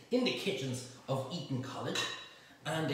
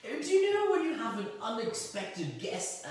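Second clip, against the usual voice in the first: high, hammed-up TV cooking host; mocking the cooking programs, hammed-up TV host voice; mock TV chef; 7 semitones higher than usual. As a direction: parody a gushing cooking show presenter. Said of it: "and they go".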